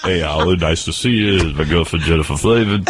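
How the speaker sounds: low, mumbling tough-guy voice; Sylvester Stallone impression. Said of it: "why is it Sylvester Stallone over my house".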